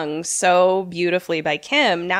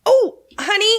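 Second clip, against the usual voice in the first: sweet high voice; 9 semitones higher than usual. Pretend. Sweetly calling for the bell.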